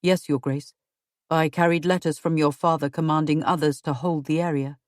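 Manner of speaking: husband's voice. Acted.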